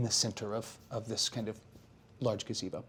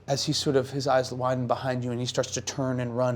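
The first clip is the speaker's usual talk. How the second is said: usual speaking voice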